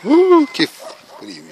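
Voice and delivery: long loud shivery cry of cold; drawn-out shout against the cold. Real, not acted. Reacting to freezing frost.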